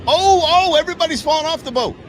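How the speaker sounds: loud, high, fast outcry; alarmed commentary. Real, not acted. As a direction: call the disaster as it happens. Calling out a boating mishap live.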